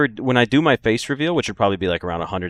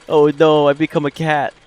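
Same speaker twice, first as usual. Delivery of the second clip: loud, high cry of fake alarm; mock dismay; 6 semitones higher, 7 dB louder than usual. Streamer after meowing for a donor, mock dismay. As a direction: play up the horror, high and loud.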